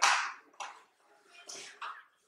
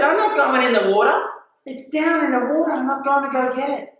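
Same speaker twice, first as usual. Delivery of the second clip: high, loud, insistent protest; retold indignant refusal; 10 semitones higher, 4 dB louder than usual. Diver refusing friends' order to go back in, high indignant refusal. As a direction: refuse point-blank with raised pitch.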